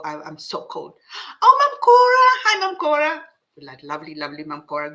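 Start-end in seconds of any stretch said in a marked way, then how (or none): 1.4-3.2 high loud cry of welcome; delighted greeting. Real, not acted. Host welcomes a viewer by name, delighted.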